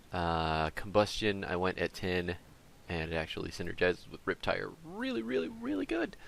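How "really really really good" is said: squeaky high voice. Pretend.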